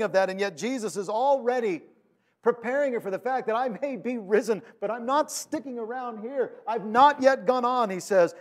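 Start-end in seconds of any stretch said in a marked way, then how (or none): 3.5-7.9 raised-pitch, emphatic gentle refusal; firm explaining. Acted. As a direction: set her straight, stressing each point. Jesus warns Mary against clinging.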